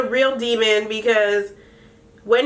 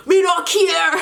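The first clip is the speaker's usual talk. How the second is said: higher, louder shout in mock Jamaican Patois; 8 semitones higher, 4 dB louder than usual. Imitating [name] shouting back in mock Jamaican Patois. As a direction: fling it out in a put-on Jamaican Patois. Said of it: "Spice starts cussing in Patois".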